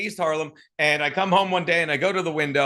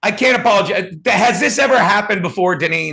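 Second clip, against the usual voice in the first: loud, pushed, apologetic; loud apology; 10 dB louder than usual. Real, not acted. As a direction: project over the bad mic. Loud apology over broken mic.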